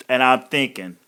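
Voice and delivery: ordinary delivery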